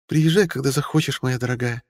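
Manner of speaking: sweet high noblewoman; old countess voice. A narrator's reading. Mariel voiced in the story.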